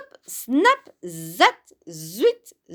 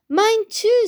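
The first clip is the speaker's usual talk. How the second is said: high, louder chime-in; excited agreement; 12 semitones higher, 5 dB louder than usual. A narrator's reading. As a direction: chime in eagerly. Second character joins the delight.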